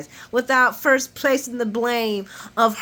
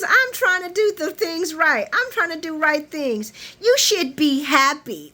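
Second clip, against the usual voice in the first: high-pitched, plaintive imitation; mock indignant pleading; 7 semitones higher than usual. Imitating Nina defending herself to Carly, indignant. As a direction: protest righteously, stretched at the end.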